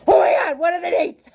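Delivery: high, loud panicked cry; panicked outburst. Retold or imitated. Learning the class is soon, panicked cry. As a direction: blurt it out in a sudden panic.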